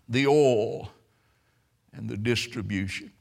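normal talking voice

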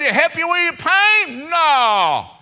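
very high, loud delivery; shouted question and answer. Acted. Preacher asked if singing helps pain, shouted exchange. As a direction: holler the question, then a long falling no.

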